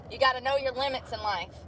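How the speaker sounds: normal talking voice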